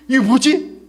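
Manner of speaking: high-pitched puzzled question. Acted. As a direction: act startled at the sight. (깜짝 놀라 높아진 목소리)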